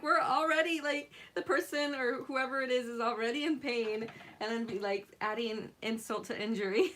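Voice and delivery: ordinary talk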